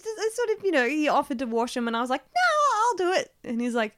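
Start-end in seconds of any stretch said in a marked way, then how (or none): 2.2-3.3 retold over-polite no; high-pitched refusal. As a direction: wave off the offer in a high voice. Retelling a polite refusal of help.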